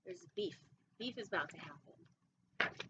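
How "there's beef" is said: ordinary delivery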